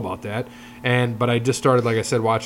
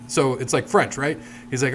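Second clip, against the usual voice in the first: usual speaking voice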